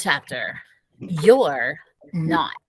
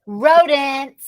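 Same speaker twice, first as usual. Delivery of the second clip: loud, high parting yell; yelling back; 7 semitones higher, 5 dB louder than usual. A narrator's reading. Sister yelling back at the family from the stairs. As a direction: fling it back while running upstairs. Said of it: "she yelled back".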